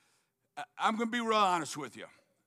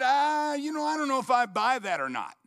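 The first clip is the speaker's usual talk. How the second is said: higher, louder and fast; skeptical scoff; 6 semitones higher, 5 dB louder than usual. Retold or imitated. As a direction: doubt it out loud. Skeptic's doubtful quote.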